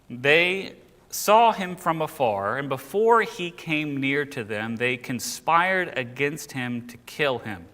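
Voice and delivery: slow, weighty verse reading; scripture reading. Acted. Teacher reading of the brothers' plot against Joseph, slow scripture reading. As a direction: draw out each phrase of the verse.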